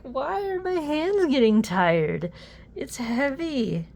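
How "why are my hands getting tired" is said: mock whine. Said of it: "I was like".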